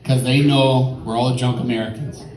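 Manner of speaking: usual speaking voice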